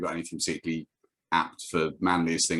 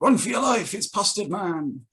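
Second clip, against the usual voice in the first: high-pitched mock cry of alarm; hammed-up, drawn-out scream of warning; mock panic; 13 semitones higher, slower than usual. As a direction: cry out like a terrified bystander in a comic. Mock panic at invented superhero.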